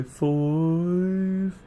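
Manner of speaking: drawn-out, high, rising Brummie "five"; Brummie accent. Shown as a demonstration. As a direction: stretch "five" long in mock Brummie, sliding up. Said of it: "different to how Brummies would say it".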